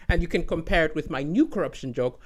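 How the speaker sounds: ordinary talk, nothing marked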